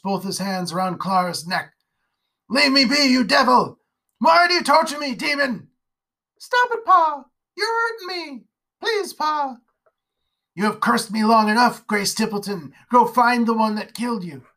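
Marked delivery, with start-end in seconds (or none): 2.5-5.7 higher, loud anguished shouting; fighting off a demon in his mind; terrified rage. Acted. Father attacking a demon. 6.4-9.6 put-on high, strained child's voice pleading; choked girl begging her father to stop; girl begging in pain. 10.5-14.5 louder, raging rebuke; banishing a cursing spirit; accusing the ghost. Acted.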